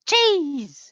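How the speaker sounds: sudden loud high Jeez; loud exclamation. Real, not acted. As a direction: blurt it out in exasperation. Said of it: "I'm sweating".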